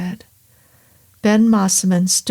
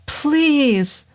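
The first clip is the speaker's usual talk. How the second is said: sharp, much higher insistent "Please"; demanding; 7 semitones higher than usual. A narrator's reading. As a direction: cut the doctor off with a demand. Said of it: "Anita demanded".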